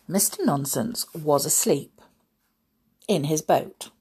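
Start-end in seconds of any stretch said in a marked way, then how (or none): none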